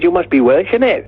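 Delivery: high-pitched imitation of a Welsh punter; Welsh accent. Local man approaches the band in Wales, Welsh accent. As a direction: put on a lilting Welsh voice, climbing high. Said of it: "when we play in Wales".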